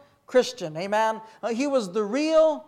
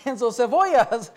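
usual speaking voice

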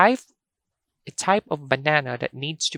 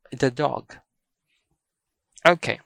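normal talking voice

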